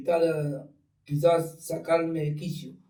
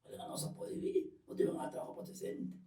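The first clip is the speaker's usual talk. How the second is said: brother's voice; 13 dB quieter than usual. Retold or imitated. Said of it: "me dice".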